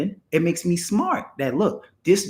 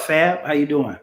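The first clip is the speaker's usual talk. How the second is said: normal talking voice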